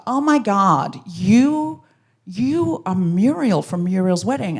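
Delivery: slurred, dragged-out fan recognition; drunk woman. Retold or imitated. Drunk woman accosting a stranger, slow amazement. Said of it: "She was very drunk".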